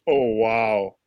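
low wail, like a playback; imitated sampled scream. Producer playing back the sampled scream, low imitation. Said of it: "he played it back to me".

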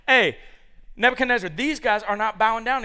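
loud, high cartoon tattling; little red anger guy. Cartoon anger guy tattling to the king, loud. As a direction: shout it like the angry cartoon guy. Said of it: "With the little red guy that's like".

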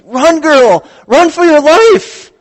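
high, loud yell, slowing on the last line; shouting a warning. Pretend. Viewer yelling at the girl on a reality show, loud warning shout. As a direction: yell at the screen to save her.

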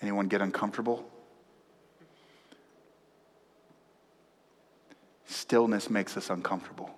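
soft, low preaching with long silences; hushed preaching. Real, not acted. Preacher asks church who gets uncomfortable in silence, hushed. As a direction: drop right down and let the stillness sit. Preacher holds a hush about stillness.

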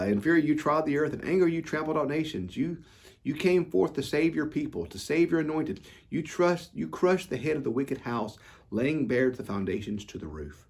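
impassioned preacher proclaiming God's fury; fervent scripture reading. Acted. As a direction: declare it with rising passion. Rereads verses with fervour.

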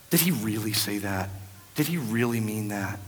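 lightly imitated sceptics; a hint of an insinuating doubter; doubters' voice. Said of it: "all others are saying".

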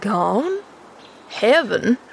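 bewildered echo of the news; mildly dazed questioning; stupefied. A narrator's reading. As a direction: repeat it blankly, as a question. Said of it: "he repeated stupidly".

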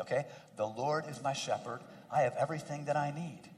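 gentle, low guided affirmation; calm, soft guidance. Acted.

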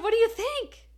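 hope bursting through the question; eager question. Retold or imitated. Asking husband, nervous excitement.

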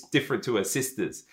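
usual speaking voice

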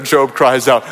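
ordinary talk

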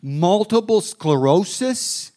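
aghast at what he promised; stunned disbelief. Acted. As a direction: stretch it out like you can't believe it. Preacher recalls his own shock.

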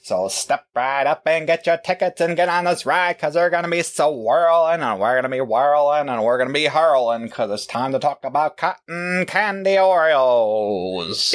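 high, hyped arena announcer voice; bending match announcer. Acted. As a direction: call it out like a showman at the ring. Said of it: "trying to be like, uh, the announcer in the, the bending match".

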